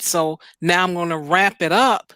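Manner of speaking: ordinary delivery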